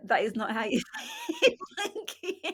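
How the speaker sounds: high, laughing protest; mock them through laughter; laughing correction. Retold in-laws laughing at her monkey. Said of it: "they're like".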